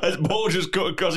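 usual speaking voice

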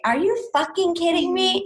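mock-stunned reaction, not yet screamed; retold disbelief. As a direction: ask it in stunned disbelief, pitch up. Retelling her shocked proposal reaction.

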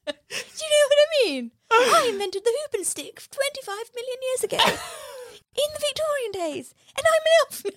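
very high squeaky elf voice, laughing; a giggling, tiny old elf; ancient British elf. Retold or imitated. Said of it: "the way he talks".

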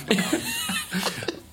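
high-pitched burst of laughter; cracking up in a squeal; high laughing fit. Real, not acted. Big laugh.

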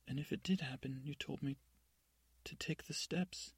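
a hint of tearful pleading, rising at the end; mildly voiced crying Cinderella, breaking on the last words; tearful confession. Cinderella confessing to her stepmother, a touch of tears. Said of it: "And she's crying, crying".

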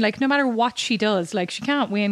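usual speaking voice

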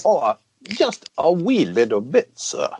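hammed-up English accent, deferential. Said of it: "That was my English accent".

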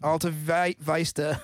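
"Vista" as VICE-ta; "Vista" mangled with an eye vowel; deliberate mispronunciation. Shown as a demonstration. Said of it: "how do I mispronounce this".

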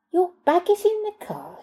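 lightly voiced foreign nanny. A narrator's reading.

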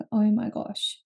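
ordinary talk

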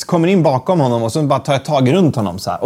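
usual speaking voice

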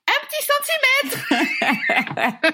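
high squeaky dismay; disbelief. Retold or imitated. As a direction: pitch it up in shocked disbelief. Choc d'être à un centimètre. (stupeur aiguë et incrédule)

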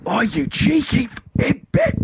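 high-pitched imitated outrage; retold full-on outburst. Friend confronting the pint thief, mimicked in high outraged voice. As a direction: mimic her kicking off indignantly. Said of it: "Went full on it".

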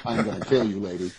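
mock threat through a laugh.

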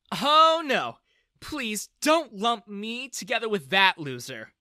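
loud emphatic denial; disgusted. A narrator's reading. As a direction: recoil at the idea. Daughter rejects father.

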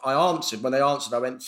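ordinary talk, nothing marked

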